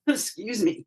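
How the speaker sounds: startled, clearing the throat; just swallowed a bug. Real, not acted. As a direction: caught off guard by a bug. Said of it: "I think I swallowed a bug".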